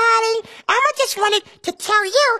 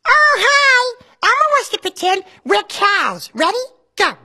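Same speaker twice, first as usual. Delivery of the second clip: Elmo voice. Acted. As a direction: speak as cheerful Elmo to a child. Elmo's voice throughout.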